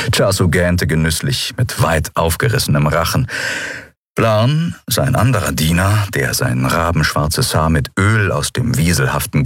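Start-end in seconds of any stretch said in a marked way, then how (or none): none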